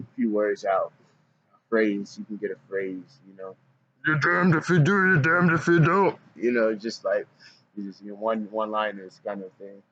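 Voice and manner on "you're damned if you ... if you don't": cartoon voice one-liner. Shown as a demonstration. Vlogger demoing his funny voice to chat, high and slowed down. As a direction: deliver it like a goofy cartoon catchphrase. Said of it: "a one-liner in that voice".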